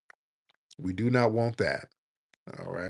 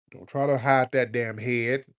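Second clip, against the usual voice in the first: ordinary delivery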